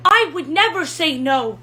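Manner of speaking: high, loud, overwrought romantic declarations; hammed-up Ferrelton. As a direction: swoon over a Valentine in character. Ferrelton character voice.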